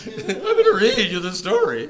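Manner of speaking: very high, loud, laughing squeak; mock puberty squeak. Teacher joking after a voice crack, hammed-up high squeak. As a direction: send the voice leaping up like a cracking adolescent. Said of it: "Puberty again".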